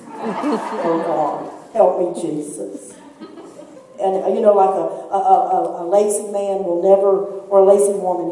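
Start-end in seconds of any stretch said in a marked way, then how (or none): 0.0-2.9 laughing confession. Retold or imitated. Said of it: "I've read that and go".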